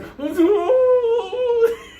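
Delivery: shrill rising cry of pain, held long; high pained wail. Retold or imitated. Chinese patient crying out as the towel comes off, high pained wail. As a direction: wail like a man whose tooth is throbbing.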